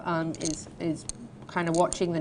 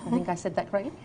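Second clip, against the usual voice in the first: usual speaking voice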